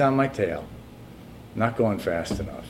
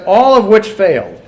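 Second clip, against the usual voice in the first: high, loud declamation of a quoted line; preacher's reading; 9 semitones higher, 11 dB louder than usual. Acted. Preacher quotes the failed communes verdict, loud and raised. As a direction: drive the written verdict home. Reads the punchline forcefully.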